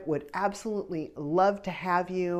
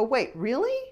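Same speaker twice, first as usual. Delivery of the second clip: disbelieving wait really; 8 semitones higher than usual. Retold or imitated. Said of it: "and I go".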